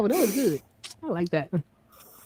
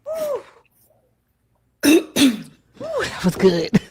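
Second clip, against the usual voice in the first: laughing; 9 semitones higher, much slower than usual. Real, not acted. Recovering from laughing fit.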